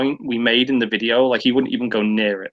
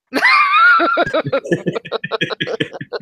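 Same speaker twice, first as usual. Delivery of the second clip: big squealing laugh, high; a loud rising laugh at the new guy's name; 3 dB louder than usual. Real, not acted.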